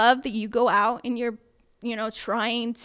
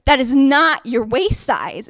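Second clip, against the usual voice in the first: firm insistence; 2 semitones higher, 9 dB louder, slower than usual. Retold or imitated. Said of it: "And he says".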